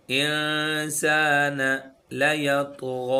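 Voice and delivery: slow, melodic tajweed chant; Quran recitation. Shown as a demonstration. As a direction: recite slowly, with each sound drawn out.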